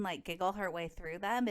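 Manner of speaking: normal talking voice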